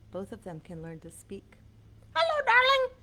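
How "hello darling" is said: high, loud squawky parrot. Acted. Showing kids how a parrot talks, high squawk. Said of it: "That's what this bird says".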